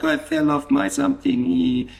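put-on very high, slow child's voice; little girl voice. Little girl telling the doctor how she fell, very high child voice. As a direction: squeak it out like a small hurt girl. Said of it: "and then the child".

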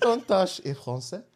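slow, high mock French accent. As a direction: mimic his French accent, amused. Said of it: "That's French".

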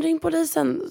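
högt, frågande ropande. Retold or imitated. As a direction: ropa igen med stigande ton. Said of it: "hon börjar skrika till mig på spanska".

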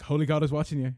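loud, stern holy warning; nana's warning. Retold or imitated. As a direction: boom it out like a strict granny. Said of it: "my nana would always say".

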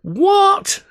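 very high, loud outraged howl. A narrator's reading. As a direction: wail it in outrage. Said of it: "I howled".

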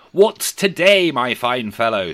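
put-on slow, high and loud old Scrooge. A narrator's reading. Scrooge calling down to the boy, slow and loud. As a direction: draw the question out grandly.

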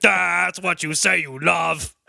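slow, stretched-out mock bailiff voice; bailiff character. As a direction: draw it out as a put-on bailiff. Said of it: "the bailiff comes and says".

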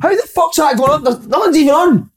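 how is that even possible, rising high; incredulous outrage. Real, not acted. Complaining to mates about his smart meter, incredulous high voice. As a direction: voice jumps high in disbelief.

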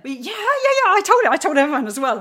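ordinary talk, nothing marked

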